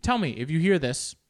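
ordinary talk

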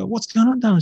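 high-pitched tender question; concerned parent. Retold or imitated. Father finds his little girl crying, tenderly concerned. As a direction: coo it to an upset child.

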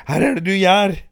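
put-on mother shouting at her resisting girl. A narrator's reading. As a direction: yell it at the struggling daughter. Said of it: "ropte Helene".

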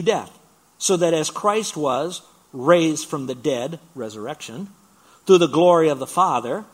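reading Romans aloud with preaching weight; preached scripture reading. Acted. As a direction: proclaim the verse to the church.

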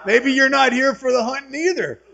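high, loud comic jab; loud teasing challenge. Real, not acted. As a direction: throw the punchline back at the crowd. Turns punchline on laughing audience.

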